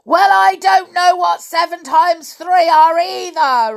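high, very loud giant bellow; furious storybook giant; angry roar. A narrator's reading. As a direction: roar it out in exasperation. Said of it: "roared the giant".

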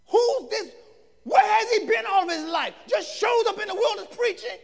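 incredulous preaching. Acted. Onlookers questioning John's background, high incredulous preaching.

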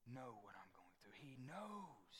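hushed confiding whisper. Real, not acted. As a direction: lean in and whisper it. Sermon drops to a whisper.

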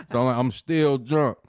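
usual speaking voice

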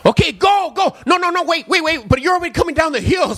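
high, loud, frantic go then no wait; panicky kid. Retold or imitated. Kid directing traffic panics as the rider comes down. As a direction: flip from go to stop in a panic.

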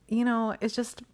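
usual speaking voice

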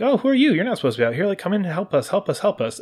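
a sweet, pleading villager calling you inside; friendly villager. Retold or imitated. Villagers welcoming the stranger to their village, in a high eager voice.

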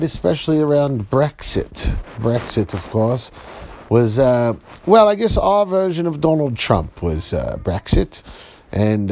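put-on British BBC presenter; British accent. Dad improvising a BBC report for the kids, British accent. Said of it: "Even say you're in a British a-, accent".

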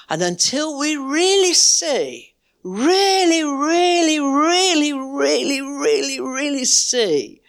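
preacher hammering really again and again; building emphasis. Acted. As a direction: pile up the reallys, climbing. Building repeated really in sermon.

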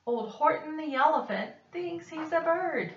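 a mocking, jeering yell; taunting. A narrator's reading. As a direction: tease the poor elephant. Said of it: "they yelled".

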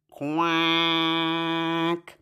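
lone drawn-out duck quack. Acted. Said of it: "Duck has the last word".

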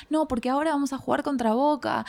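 excited. Retold or imitated. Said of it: "yo estaba re emocionada y le decía".

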